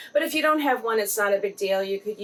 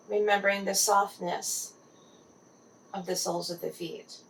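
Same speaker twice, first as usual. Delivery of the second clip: guided meditation. Acted. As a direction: gently guide the listener into relaxed body awareness.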